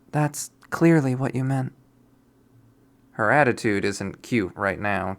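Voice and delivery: plain reading voice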